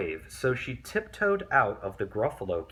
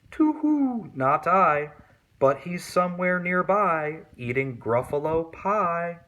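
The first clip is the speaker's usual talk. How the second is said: owl; 4 semitones higher than usual. Acted. Owl telling the child the mouse is nearby, hooting high. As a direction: hoot it out like an owl.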